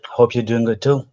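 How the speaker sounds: ordinary talk, nothing marked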